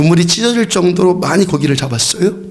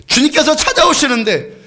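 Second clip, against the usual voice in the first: fervent preaching; 8 semitones higher than usual. Acted. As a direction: preach it out with rising fire. Preaching at full force.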